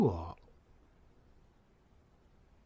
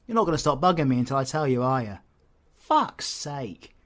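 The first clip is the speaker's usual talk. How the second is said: exasperated; 3 semitones higher, 8 dB louder than usual. Acted. Exasperated at being pestered.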